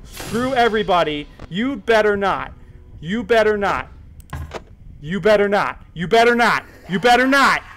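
high, loud repeated warning, building; frantic warning. Real, not acted. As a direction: call it out in rising panic. Keeps warning the game threat.

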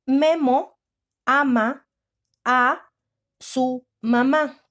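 slow, loud, word by word for the class; spaced-out dictation voice. Shown as a demonstration. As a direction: dictate each word slowly and clearly. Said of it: "Primer tiempo".

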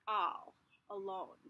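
plain reading voice

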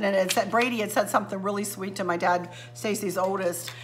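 normal talking voice

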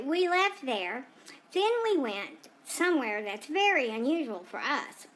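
usual speaking voice

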